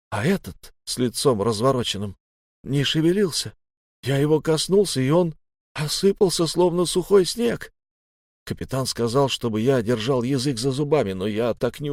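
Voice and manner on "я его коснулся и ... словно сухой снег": high-pitched, disbelieving account; shaken. A narrator's reading. Friend telling of a body that crumbled at his touch, shaken. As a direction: voice lifts as the horror is relived.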